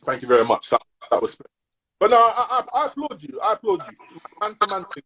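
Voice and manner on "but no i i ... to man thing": laughing. Real, not acted. Laughs while praising co-host.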